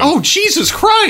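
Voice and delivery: shocked high exclamation. Real, not acted. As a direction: gasp it out in horror. Reacting to impaled women and children.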